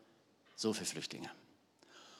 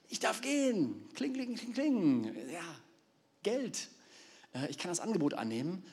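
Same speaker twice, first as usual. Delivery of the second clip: high, gleeful voice mocking greed with kling kling; greedy Balaam; 7 semitones higher than usual. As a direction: hear the coins jingle in his head. Balaam's greedy thoughts acted out.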